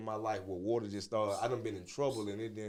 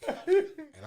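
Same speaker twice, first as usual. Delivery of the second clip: laughing; 9 dB louder than usual. Real, not acted. Laughing at Tyler Perry's request.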